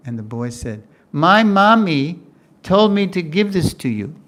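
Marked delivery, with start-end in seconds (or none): 1.1-4.2 put-on high, loud, slow child voice; a child announcing his mother's errand; little boy voice. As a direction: speak it like a small boy delivering food.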